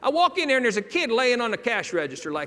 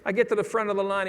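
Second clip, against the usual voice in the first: ordinary talk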